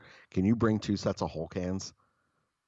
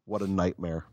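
usual speaking voice

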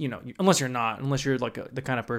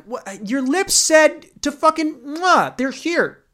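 mock indignant girl; 9 semitones higher, 5 dB louder, slower than usual. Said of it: "and you're like".

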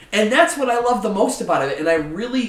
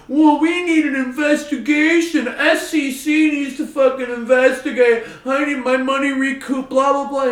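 higher, mock whiny complaining; mocking whiny hedge funds; 7 semitones higher, 5 dB louder than usual. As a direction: whine like a sore loser demanding an investigation. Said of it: "you're fucking crying and you're saying".

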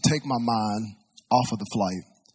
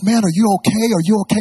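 raised, louder panicked voice acting out a worried friend; panicking; 7 semitones higher, 10 dB louder, faster than usual. Friend checking on his unresponsive pilot buddy, panicking. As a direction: act out a friend in rising panic. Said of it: "He was panicking".

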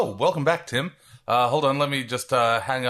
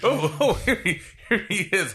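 laughing; 9 semitones higher than usual. Real, not acted. Laughing while introducing correspondent.

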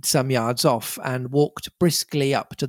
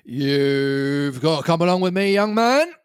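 London policeman; 3 semitones higher than usual. A narrator's reading. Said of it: "said the policeman".

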